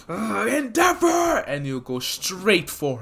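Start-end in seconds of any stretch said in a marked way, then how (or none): 0.1-1.4 slow, much higher shout of the name; Dabi's shout. Retold or imitated. Dabi spotting Endeavor on the battlefield, slow high shout. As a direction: yell at him with hate. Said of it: "just be like".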